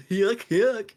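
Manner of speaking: mock a goofy Southern accent; joke country accent. Shown as a demonstration. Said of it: "joke accent for so long".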